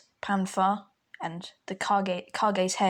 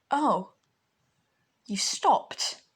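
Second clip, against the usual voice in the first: mock surprised; 2 semitones higher, much slower than usual. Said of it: "like, acting all surprised".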